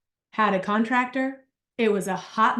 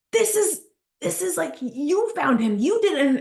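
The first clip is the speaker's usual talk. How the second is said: higher, urgent mock protest; husband's protest; 5 semitones higher than usual. Husband elbowing her at lunch, mock protest. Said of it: "he was like".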